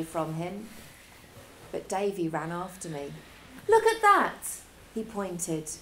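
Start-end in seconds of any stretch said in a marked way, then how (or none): 3.7-4.6 excited pointing. Acted. Davy excitedly points at rainbow.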